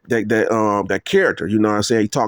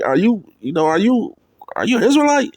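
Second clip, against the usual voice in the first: curious question; 10 semitones higher than usual. Retold or imitated. Recognizing a man from videos.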